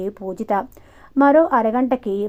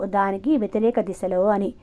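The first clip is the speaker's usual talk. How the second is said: ordinary delivery, nothing marked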